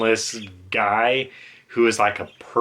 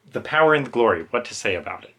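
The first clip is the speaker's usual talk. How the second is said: ordinary talk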